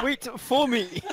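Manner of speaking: laughing mimic.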